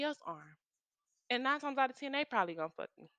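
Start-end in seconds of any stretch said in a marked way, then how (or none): none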